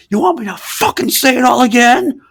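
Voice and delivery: mock angry yell, high and loud; retold scream. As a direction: yell it like a furious old man. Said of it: "He just screamed at me".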